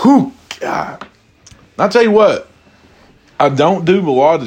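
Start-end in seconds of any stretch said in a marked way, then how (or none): 0.0-0.3 burning gasp. Real, not acted. Reacting to strong tequila shot.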